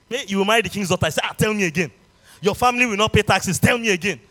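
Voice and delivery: loud, fervent preaching. Acted. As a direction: preach it at full force. Preaching at full force.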